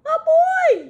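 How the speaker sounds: very high, very loud alarmed yell; startled bear's drawn-out shout. A narrator's reading. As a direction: bellow high in storybook panic. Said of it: "yelled the bear".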